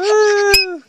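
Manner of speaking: high, loud whoop; excited cheer. Real, not acted. Mother cheers as the kids start camping. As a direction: let out a big happy whoop.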